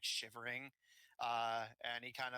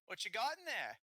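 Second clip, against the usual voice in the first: high, louder bandit challenge; bandit leader; 11 semitones higher, 5 dB louder than usual. Acted. Bandit to the travellers at the cart, challenging. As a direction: demand it as the bandit in charge.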